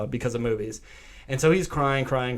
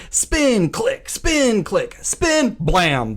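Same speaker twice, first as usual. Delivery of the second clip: high, louder, rhythmic spin click; mimicking the revolver clicks; acted-out roulette beats; 8 semitones higher, 5 dB louder, much slower than usual. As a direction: build tension spin by spin. Acting out Russian roulette.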